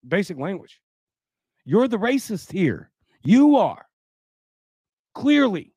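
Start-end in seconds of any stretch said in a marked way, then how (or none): 1.6-5.7 heated accusation. Real, not acted. Accusing the article writer.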